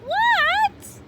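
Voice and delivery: squeaky high startled frog; high froggy voice. Acted. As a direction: answer like a surprised little frog. Froggy answering his teacher.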